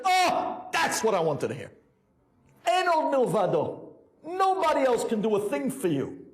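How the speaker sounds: high, emphatic exclaiming; impassioned acted proclamation; exultant Rebbe. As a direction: declare it with rising fervor, pitched high. Rebbe exults at the man's cry.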